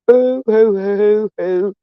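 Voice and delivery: high fake hoo-hoo crying, fading; hammed-up fake sobs trailing off; mock crying hoo-hoo dying away; mock boohoo sobbing. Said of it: "making me cry".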